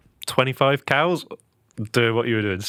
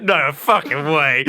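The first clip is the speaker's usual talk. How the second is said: slow, laughing disbelief; stunned, can't believe it; amazed disbelief; 4 semitones higher, 3 dB louder, slower than usual. Retold or imitated. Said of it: "I was like".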